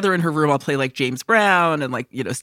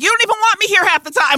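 fast, high, loud mock retort; 6 dB louder, faster than usual. Retorting to grandma in a high, fast voice. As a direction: fire it back high and quick.

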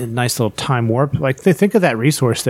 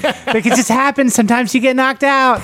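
high-pitched, cheerful mimicry; chipper fighter impression; 10 semitones higher than usual. Hurt fighter explaining a knockout to fans, chipper. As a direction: shrug off a knockout in a perky mock voice. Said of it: "he was totally chipper".

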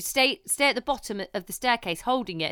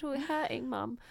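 fake crying; 3 semitones higher, 7 dB quieter than usual. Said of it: "some fake crying".

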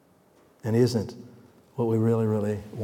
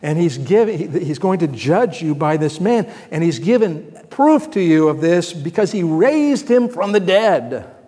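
louder, higher preaching voice; Paul preaching to the Athenians, loud and rising; 4 semitones higher, 7 dB louder than usual. Retold or imitated. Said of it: "and he says".

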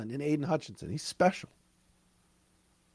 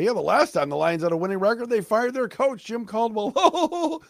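mocking critics; 7 semitones higher than usual. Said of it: "I know what some other people are gonna say".

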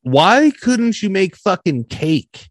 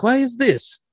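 higher-pitched imitation of a questioning doctor; blunt doctor; 9 semitones higher, 4 dB quieter, slower than usual. Doctor questioning depressed comedian, blunt and high. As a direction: play the doctor asking bluntly. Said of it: "like, very blunt".

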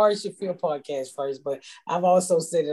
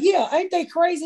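naive amused high; 12 semitones higher than usual. Retold or imitated. Her own younger self laughing.

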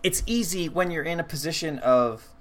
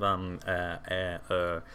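lightly imitated hemming and hawing; soft, low string of hesitant filler noises; Jeff Goldblum hemming; 6 semitones lower, 7 dB quieter than usual. Imitating an actor's nervous stammer, mild hemming and hawing. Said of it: "a lot of hemming and hawing".

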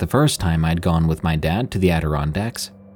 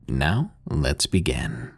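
low, soft, slow and ominous; ominous late night radio; 7 semitones lower, 6 dB quieter, much slower than usual. Acted. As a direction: lower the voice to start the tale. Host opens the scary story.

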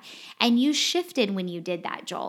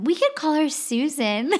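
higher and louder, teasing, ends in a laugh; tossing out a silly alias, laughing; playful joke; 8 semitones higher, 6 dB louder than usual. Acted. Host jokes about giving the counselor a fake name, giggling.

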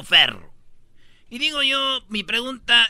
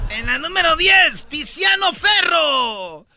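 loud, higher, drawn-out announcement; announcer shout; 7 semitones higher, 4 dB louder, slower than usual. Acted. Host announces story number ten, loud and higher. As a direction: announce the next story like a showman. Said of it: "host announcing next item".

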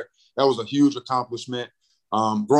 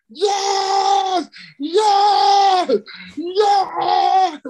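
retold joyful screams, much higher and louder; screaming yes; 6 dB louder, much slower than usual. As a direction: relive yelling yes in the front yard. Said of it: "just screaming".

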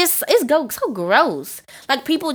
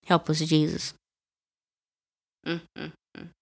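weary lament; 5 semitones lower, 7 dB quieter than usual. Real, not acted. Dismayed prayer after rant.

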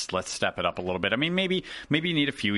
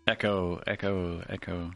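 fading echo, each echo softer; digital echo; 5 dB quieter, slower than usual. Pretend. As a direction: repeat it like a dying echo. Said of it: "I was trying to make it sound epic".

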